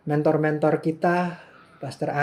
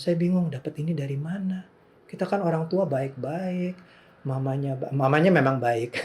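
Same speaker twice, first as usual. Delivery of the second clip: ordinary talk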